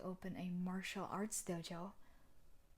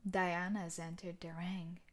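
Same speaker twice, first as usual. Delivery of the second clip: ordinary talk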